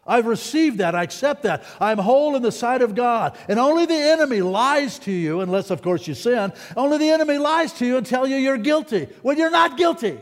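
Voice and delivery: high, impassioned preacher building in force; worked-up pulpit proclamation, loud on the key phrases; excited, emotional preaching. Real, not acted. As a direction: preach it fervently, pitch riding high. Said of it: "am I getting too excited and emotional".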